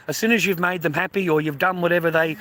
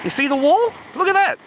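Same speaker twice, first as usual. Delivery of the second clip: much higher and louder, thrilled; excited; 12 semitones higher, 5 dB louder than usual. Real, not acted. Showing viewers the sand wall, excitedly high and loud. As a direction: point it out with real excitement.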